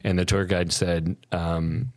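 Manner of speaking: normal talking voice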